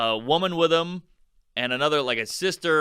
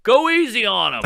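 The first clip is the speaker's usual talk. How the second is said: heckler calling out; 8 semitones higher, 6 dB louder than usual. Retold or imitated. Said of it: "this guy in the back goes".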